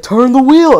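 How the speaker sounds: imitated dad shout, loud and high; exasperated retold yell; angry yell. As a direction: holler the order from the passenger seat. Retold driving quarrel, dad yelling.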